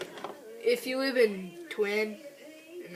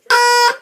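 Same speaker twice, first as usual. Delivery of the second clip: loud, high, quacking honk; duck call honks; 20 dB louder than usual. Shown as a demonstration. Testing duck calls on camera.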